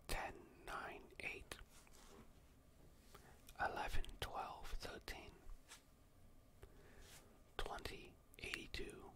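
slow, very soft whisper; hushed, voiceless counting for a hearing test. Pretend. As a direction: whisper the numbers into the listener's ear, leaving long gaps. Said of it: "I'm gonna be whispering some numbers".

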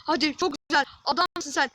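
loud, punchy encouragement; excited cheering. Real, not acted. Gamer cheering his player after a good move, excited shout. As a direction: shout praise to spur the player on.

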